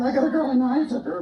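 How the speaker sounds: squeaky high puppet voice, shouted out; a cheeky dummy yelling hello from the box; ventriloquist dummy Bob. Acted. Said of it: "we're gonna do some ventriloquism here".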